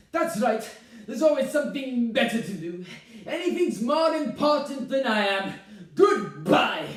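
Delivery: loud, wounded, sarcastic mother; martyred fury. Acted. Mother hanging up on her son, offended. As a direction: build to a slammed-down goodbye.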